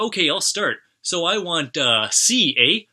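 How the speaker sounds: a cheery settler staking his letter; mock Canadian accent. First guy picks a letter.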